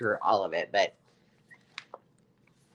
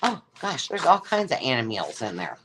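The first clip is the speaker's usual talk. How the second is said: ordinary talk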